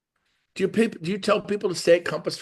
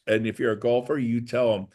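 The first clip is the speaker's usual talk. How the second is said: usual speaking voice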